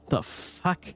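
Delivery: cracking, high-pitched disbelief. Acted. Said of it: "your voice is starting to go out".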